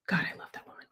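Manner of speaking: real whispered aside of admiration. Real, not acted. Coach whispering her admiration for her friend. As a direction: breathe it out as a private whisper.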